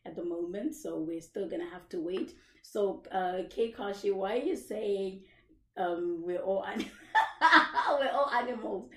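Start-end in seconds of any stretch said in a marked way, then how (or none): none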